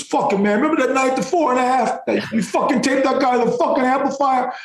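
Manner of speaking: excited high-pitched New York guy; New York Sopranos voice. Retold or imitated. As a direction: mimic a worked-up New York wiseguy. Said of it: "voice is one of these New York".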